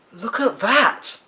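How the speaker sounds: put-on a boy marvelling at the frozen fountain, slow and high; exclaimed. A narrator's reading. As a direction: point and marvel, drawn out. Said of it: "Charlie exclaimed".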